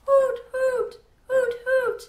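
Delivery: high hooting cry of an owl; owl hoots. A narrator's reading. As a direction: hoot like a night owl, high and loud. Owl calls again.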